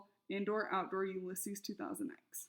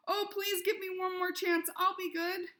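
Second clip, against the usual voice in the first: high, loud mock begging; pleading squirrel; 10 semitones higher, 5 dB louder than usual. A narrator's reading. Imagined squirrel pleading with fate, hammed-up begging. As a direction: beg comically for another chance.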